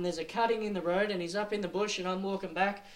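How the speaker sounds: normal talking voice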